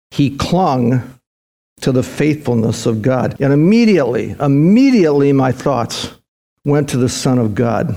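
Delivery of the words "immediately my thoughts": ordinary talk, nothing marked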